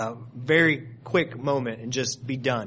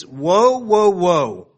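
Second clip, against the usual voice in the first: woe cried out loud and high; loud angel's cry; 5 semitones higher, 9 dB louder, much slower than usual. A narrator's reading. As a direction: ring out the angel's warning cry. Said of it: "saying with a loud voice".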